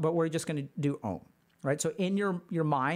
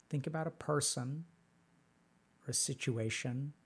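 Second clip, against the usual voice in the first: guided meditation; 5 semitones lower, 6 dB quieter than usual. Acted. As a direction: lead them gently through memory. Leads a meditation.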